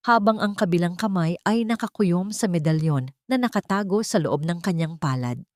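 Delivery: plain reading voice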